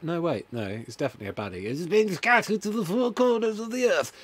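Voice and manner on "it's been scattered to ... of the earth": high, loud sinister villain. Acted. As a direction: proclaim it like a cult leader. Baddie voice.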